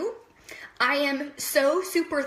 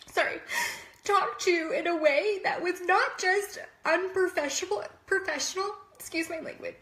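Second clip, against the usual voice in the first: higher, halting speech close to tears; real, holding back crying; 3 semitones higher, 3 dB quieter than usual. Real, not acted. Telling viewers about being harassed at work, close to crying. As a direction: fight back tears, pausing to keep going. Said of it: "sorry, I'm gonna start crying".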